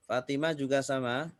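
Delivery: usual speaking voice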